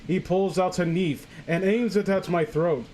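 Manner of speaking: normal talking voice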